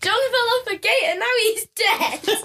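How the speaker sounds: high, overdramatic mock wail, well up in pitch; dramatic mimicry. Mocking how dramatically her friend said it, high hammed-up wail. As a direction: ham it up like a melodrama. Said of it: "That's what it sounded like".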